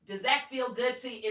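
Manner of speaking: high, loud, hard question. Shown as a demonstration. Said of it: "In this tone".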